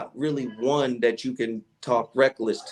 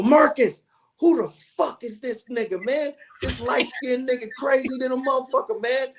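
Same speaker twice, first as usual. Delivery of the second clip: Jamie impression; 13 semitones higher than usual. Jamie off air to Marcus, high disbelieving rant. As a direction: play a rattled Jamie, high and outraged.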